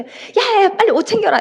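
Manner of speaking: high-pitched, exasperated hurry-up; acted frantic call to a dawdling friend; impatient urging. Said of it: "그러면 막 속이 타죠". (속이 타서 다그치는 말투)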